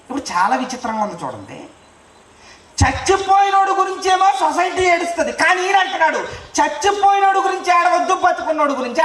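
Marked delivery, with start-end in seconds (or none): 2.8-9.0 raised, higher-pitched pulpit delivery; forceful preaching. Real, not acted. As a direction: preach it out insistently to the congregation. (గొంతు పెంచి ఉద్వేగంగా బోధిస్తూ)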